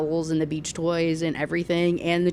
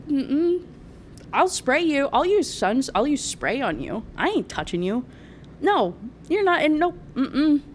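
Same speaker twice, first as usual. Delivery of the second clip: higher, sassy refusal; mock refusing wife; 7 semitones higher than usual. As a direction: play the wife who won't touch him. Wife refuses to touch husband.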